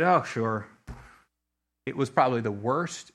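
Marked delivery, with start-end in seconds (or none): 0.0-0.7 low, half-hearted agreement; worn-out, low-pitched consent; tired reluctance. Retold or imitated. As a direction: imitate a weary, grudging yes. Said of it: "I was tired and I said".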